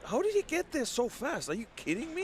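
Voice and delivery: high-pitched, incredulous questions; real astonishment. Real, not acted. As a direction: react in sheer disbelief, voice shooting up. Astonished at Joe's sudden appearance.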